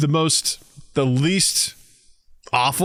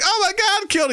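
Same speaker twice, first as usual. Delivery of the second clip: mock panicked wife, pitched way up; mock high shriek. Husband spots a spider, wife shrieks in mock panic. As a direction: squeal it like a terrified wife. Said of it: "She's like".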